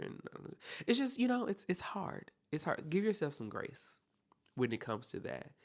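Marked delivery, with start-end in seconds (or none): none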